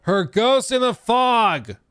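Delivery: hammed-up eerie announcement; dramatic reading. Shown as a demonstration. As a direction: deliver it like a spooky trailer. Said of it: "You give me your best".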